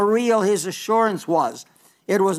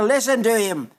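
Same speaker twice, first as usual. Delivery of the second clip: ordinary talk, nothing marked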